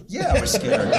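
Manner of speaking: high, laughing admission; real laughter on the words. Real, not acted. Admitting fear while laughing.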